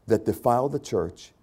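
usual speaking voice

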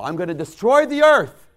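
loud, high booming decree; loud divine threat; voice of God. Retold or imitated. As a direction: proclaim it as an angry God. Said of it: "God comes to Noah".

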